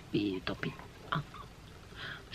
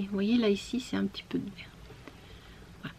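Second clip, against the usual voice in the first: unvoiced close whisper while pointing; hushed aside about the green thread; 8 dB quieter, faster than usual. Real, not acted. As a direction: breathe the words as if leaning in. Showing detail close up.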